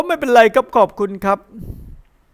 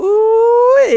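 surprised squeal; 12 semitones higher, 5 dB louder, much slower than usual. Acted. Sudden squeal mid-story.

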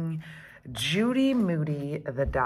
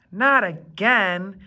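louder, slow, fed up; fed-up groan; 4 semitones higher, 7 dB louder, slower than usual. Acted. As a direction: drag it out, sick of the rain. Judy groans at rain.

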